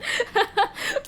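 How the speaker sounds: a high rising giggle; giggling as the stream ends. Real, not acted. Final laugh.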